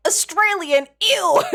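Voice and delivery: high, loud mock-disgusted squeal on ew; mocking a visitor's disgust. Streamer imitating a visitor sneering at Australians, high mock disgust. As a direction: squeal ew as if the word tastes bad. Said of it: "they were like".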